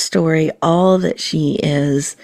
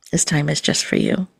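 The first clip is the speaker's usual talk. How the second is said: guided meditation. Acted. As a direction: gently guide the listener into calm and presence.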